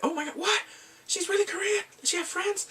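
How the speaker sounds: high, astonished gasping questions; amazed disbelief. Retold or imitated. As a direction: squeal it like you can't believe it. Miners react in disbelief.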